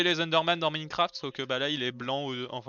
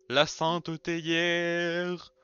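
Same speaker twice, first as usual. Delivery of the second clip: mock solemn reveal; much slower than usual. Solemnly presenting the teapot.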